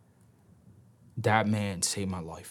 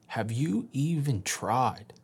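slow pointed question; 2 semitones higher, much slower than usual. Retold or imitated. Counselor staring him down.

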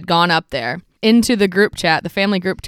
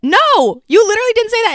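higher, louder back-and-forth; mock bickering; 11 semitones higher, 4 dB louder than usual. Sisters quoting the argument with their brother, higher and heated. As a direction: argue it out like siblings. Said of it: "And he said".

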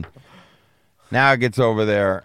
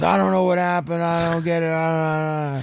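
higher, baffled mechanic voice; imitating a clueless mechanic; 6 semitones higher than usual. Mechanic shrugs off the broken car, lightly mocked baffled voice. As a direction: play him helpless and confused, a bit higher.

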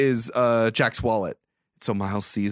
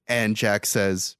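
ordinary delivery

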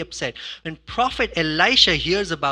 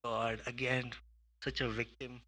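dismissive sigh; 6 semitones lower, 7 dB quieter than usual. Retold or imitated. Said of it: "I'm like".

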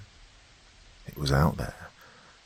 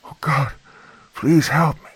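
high pleading woman's voice; trembling fear; 9 semitones higher, 4 dB louder than usual. A narrator's reading. Woman at the door begging the girl, trembling. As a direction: beg with a trembling voice. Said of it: "fear in her trembling voice".

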